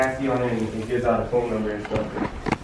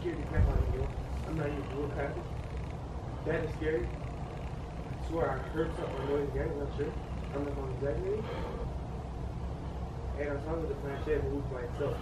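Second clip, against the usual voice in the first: nervous night vlog whisper; 12 dB quieter than usual. Real, not acted. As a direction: keep it hushed, scared of being heard. Nervous whisper in haunted house.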